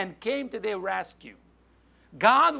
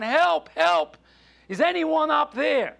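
raised, higher cry for help; yelling for help; 7 semitones higher than usual. Acted. Stranded man calls up for passersby, yelling for help. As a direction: call up to anyone who might hear. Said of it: "John began yelling for help".

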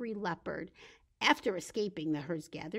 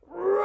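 loud, high lion roar; big roar; 11 semitones higher, 13 dB louder than usual. Shown as a demonstration. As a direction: burst out with a playful roar. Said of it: "Big roar at the end".

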